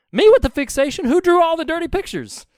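high, loud mock indignation; hammed-up outraged comeback; indignant patient. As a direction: fire back the punchline in protest. Kid's indignant punchline in joke.

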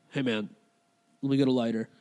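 low, casual mumble with a halting start; dazed stranger. Retold or imitated. Bleeding man asks a stranger for a light, dazed. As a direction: ask lazily, as if nothing is wrong.

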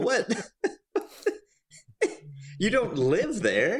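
incredulous. Acted. Mocking the old couple.

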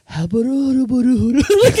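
slow, laughing fake Arabic-sounding babble; mocking Arabic gibberish through a laugh. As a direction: imitate what Arabic sounds like to Americans, laughing. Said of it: "what Arabic sounds like to American people".

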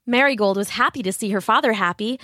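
ordinary delivery, nothing marked

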